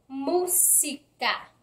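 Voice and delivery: "Música" slowly broken into separate syllables. Shown as a demonstration. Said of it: "señala la sílaba más fuerte".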